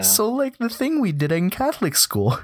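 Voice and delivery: high-pitched, mock-astonished recognition; sudden realization. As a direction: act out connecting it to your own past. Retells sudden realisation at glossary.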